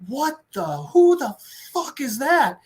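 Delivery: high, drawn-out astonishment; stunned disbelief. Retold or imitated. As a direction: act out gaping amazement. Kids astonished by stranger.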